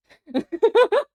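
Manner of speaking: high squealing giggle; laugh. Real, not acted. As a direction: burst of high, loud laughter. Giggling at the clip.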